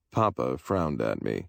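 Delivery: ordinary delivery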